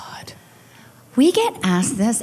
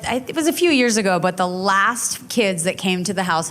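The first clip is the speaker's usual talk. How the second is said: ordinary talk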